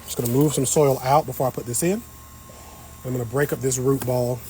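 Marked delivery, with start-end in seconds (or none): none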